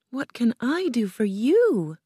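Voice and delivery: put-on high, girlish puzzled question; surprised. A narrator's reading. Dorothy asks what Oz could want, surprised. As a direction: ask up high, taken aback. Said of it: "she asked in surprise".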